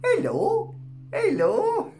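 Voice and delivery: very high, laughing and teary, rising hellos; high cutesy greeting; giddy squeals. Real, not acted. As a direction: coo at the stones like puppies. Laughing, greeting crystals cutely.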